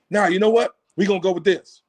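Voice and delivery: normal talking voice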